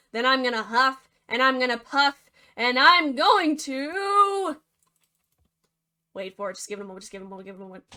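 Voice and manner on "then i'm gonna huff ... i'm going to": louder and slower toward a big windup; building wolf threat. Acted. Wolf winds up at the stick house, louder and slower. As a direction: wind up for the blow, dragging it out.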